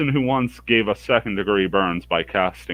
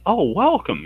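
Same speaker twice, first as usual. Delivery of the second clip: higher pitched, cheery welcome; bright shopkeeper greeting; 12 semitones higher than usual. Acted. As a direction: greet the customers sweetly, pitched up. Shopkeeper Helga greets the party.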